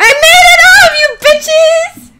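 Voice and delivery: high, loud crowing; triumphant shout. Real, not acted. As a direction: shout your victory over the others. Crows after reaching the top.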